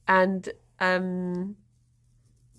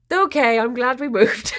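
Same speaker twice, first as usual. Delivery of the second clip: laughing relief; 6 semitones higher, 6 dB louder than usual. Real, not acted. Relief after front row scream.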